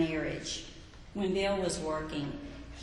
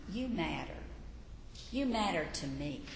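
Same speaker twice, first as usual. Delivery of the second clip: softer, lower, gently assuring someone they matter; tender reassurance; 5 semitones lower, 7 dB quieter than usual. Shown as a demonstration. Preacher softly voicing what good listening tells a person, tender. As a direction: say it softly like a heartfelt promise.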